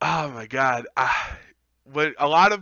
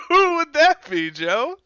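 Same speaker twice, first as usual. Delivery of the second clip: laughing; 14 semitones higher, 5 dB louder than usual. Acted. Playing along with the bit.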